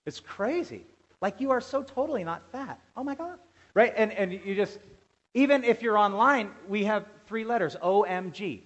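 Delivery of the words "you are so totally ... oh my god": put-on high-pitched teen gushing; mock teenager. Mimicking high school teenagers chatting to a friend, high mock gushing. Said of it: "every high school teenager".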